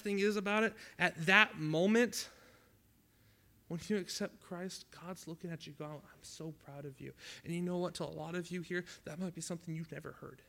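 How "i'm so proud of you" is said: much softer, slow and tender; tender pride. Acted. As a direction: say it gently, like a loving father. God speaking to new believer.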